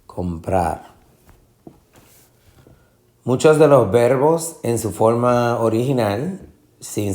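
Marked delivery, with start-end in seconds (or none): 0.0-0.9 comprar with a long stressed -prar; stress on last syllable. Shown as a demonstration. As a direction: land the force on the end of comprar. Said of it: "la sílaba tónica". (comprar con la última sílaba tónica)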